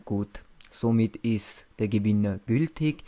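normal talking voice

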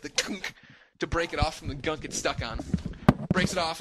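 ordinary talk, nothing marked

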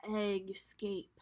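breathy whispered title. Real, not acted. Whispering the book title to young listeners.

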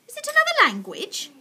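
high, loud, wondering question; baffled question. Acted. Missus Silver asking Mister Hoppy, high baffled wonder. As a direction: ask with voice leaping up in puzzlement. Woman baffled by the strange words.

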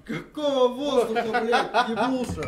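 loud, laughing mockery; laughing disbelief. Real, not acted. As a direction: laugh at him while shouting. Mocking teammate's answer.

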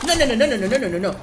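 high, rapid string of refusals; panicked. Real, not acted. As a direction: blurt it out in alarm. Panic under attack in game. (con pánico, agudo)

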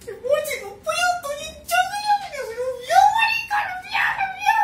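very high crying wail; sob-squeal from too much emotion; overwhelmed crying squeal. Real, not acted. Fan overwhelmed by the scene.

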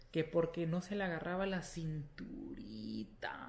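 slow hushed whisper. Real, not acted. Mother whispering about the girl's waist, slow whisper. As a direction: breathe it out secretly, slowly. Tells doctor's words about daughter.

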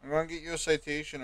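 ordinary talk

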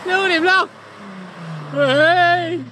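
shouting high and loud over the engine; excited cheering. Real, not acted. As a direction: cheer the driver on at full voice. Cheering car past.

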